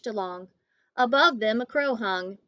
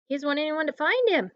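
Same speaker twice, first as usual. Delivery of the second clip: usual speaking voice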